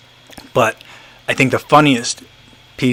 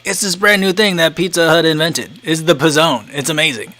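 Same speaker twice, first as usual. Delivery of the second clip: mock ad excitement, higher pitched; hyped-up sales pitch; 6 semitones higher than usual. Spokesperson unveiling Pizza Hut's brand new thing, hyped-up mock pitch. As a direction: sell it like a breathless new-product announcement. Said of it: "they tried to make it like".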